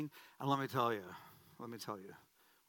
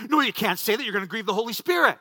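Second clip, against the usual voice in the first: louder, higher mock-panicked scolding; scolding guilty conscience; 6 semitones higher, 9 dB louder, faster than usual. Pastor's guilt rebuking his own secret thought, alarmed mock scolding. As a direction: cut in sharply as the voice of guilt. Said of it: "my Pentecostal guilt kicks in".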